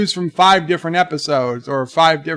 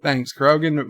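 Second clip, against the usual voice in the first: usual speaking voice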